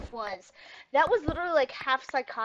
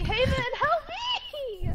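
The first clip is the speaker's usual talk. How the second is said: mock pleading cry; 13 semitones higher, slower than usual. Game character hit by arrow.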